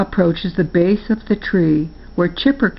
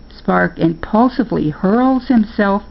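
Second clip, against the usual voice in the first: plain reading voice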